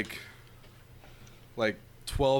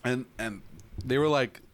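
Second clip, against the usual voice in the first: ordinary delivery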